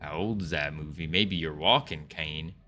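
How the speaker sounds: low, soft repeat to oneself; quiet re-reading. Pretend. Streamer re-reading a teasing chat question, quiet and low. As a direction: drop it low and soft, mulling over the question.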